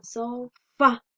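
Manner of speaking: sofá split, so-FÁ, weight on the ending; stress on last syllable. Shown as a demonstration. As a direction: separate sofá and punch the final fá. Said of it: "La mayor fuerza de voz la hacemos en la última". (sofá separado, con fuerza en la última, so-FÁ)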